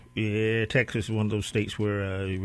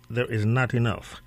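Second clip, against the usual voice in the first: ordinary delivery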